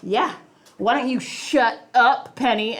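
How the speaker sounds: put-on slow sassy little boy; lightly voiced cheeky boy telling his sister off; imitating cheeky son. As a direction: drawl it like a smug kid. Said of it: "funny to hear him go".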